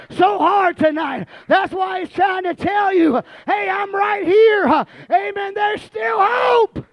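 high, loud, shouted whooping sermon; preaching at full force. Acted. Preacher voicing the father's joy to the church, shouted hard. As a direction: build the congregation up, shouting it out.

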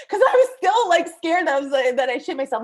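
talking through a burst of laughter, high and loud; real laughing. Real, not acted. Teller admitting fear of having soiled themself, laughing. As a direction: break up laughing, then settle back down.

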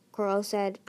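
usual speaking voice

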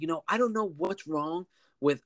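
indignant customer. Retold or imitated. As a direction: imitate an indignant customer complaining. Retold angry customer's complaint.

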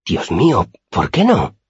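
high, aghast question; open-mouthed shock. A narrator's reading. Simón is shocked the whipping still stands, high and aghast. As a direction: ask it aghast, pitch climbing. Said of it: "me miró con la boca abierta".

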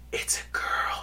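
whispered ghostly voice; eerie voice. Retold or imitated. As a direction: whisper it like an unseen spirit. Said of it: "you hear this voice".

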